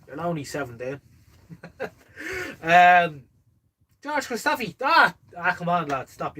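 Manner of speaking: usual speaking voice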